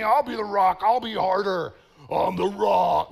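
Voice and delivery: stubborn puffed-up boast about being the rock; tough defiance. Pretend. Pastor acting a stubborn fighter, loud mock defiance. Voicing a hard, defiant person.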